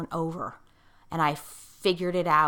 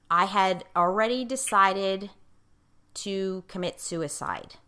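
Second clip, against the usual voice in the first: ordinary delivery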